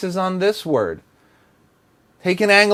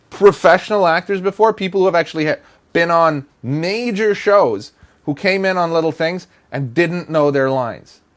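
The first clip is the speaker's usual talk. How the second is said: usual speaking voice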